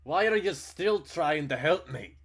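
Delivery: loud, high, slow anguished cry; a furious, bewildered yell; horror and fury. Acted. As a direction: hurl the question at him in disbelief. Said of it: "a mixture of horror and fury".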